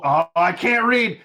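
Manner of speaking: high, loud self-mocking wail; exasperated outburst. Real, not acted. Reader fumbles the line, exasperated yell. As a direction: cry out at your own blunder.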